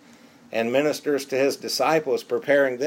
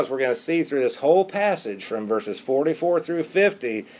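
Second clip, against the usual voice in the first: normal talking voice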